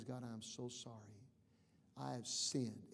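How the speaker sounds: low, soft confession; penitent prayer. Acted. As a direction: confess quietly to God, subdued. Models confessing sin to God.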